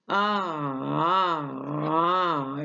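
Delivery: long wavy hummed "aah"; sing-song vowel rolling in waves; undulating "aah". Shown as a demonstration. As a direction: glide up and down on drawn-out "aah". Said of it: "اللي هي undulating دي".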